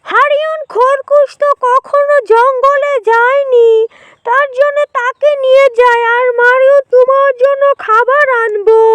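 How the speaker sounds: high animal-character voice, persuasive; lightly voiced coaxing jackal. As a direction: lightly play a jackal luring new friends.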